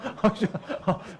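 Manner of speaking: short real laugh mid-story. Real, not acted. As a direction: break into a laugh at the act. Laughs while acting street kids. (이야기하다 터진 웃음)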